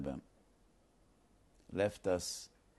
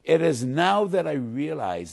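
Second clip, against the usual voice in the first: joyful declaration; 8 semitones higher, 13 dB louder than usual. Retold or imitated. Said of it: "And he said".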